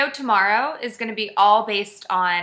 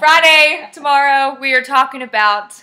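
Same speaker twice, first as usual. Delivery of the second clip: loud sign-off; 8 dB louder than usual. Real, not acted. Loud excited sign-off announcement.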